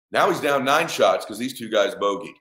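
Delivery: ordinary delivery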